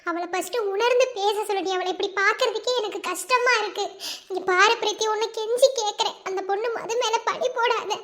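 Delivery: normal talking voice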